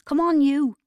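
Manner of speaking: high, loud summons; impatient yelled order; shout. A narrator's reading. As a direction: shout for them to get moving. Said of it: "she shouted at me".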